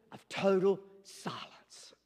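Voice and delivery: hushed whisper of a dramatic pause. Shown as a demonstration. Preacher builds suspense before Daniel answers, whispered. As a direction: breathe it out, slow, in a whisper.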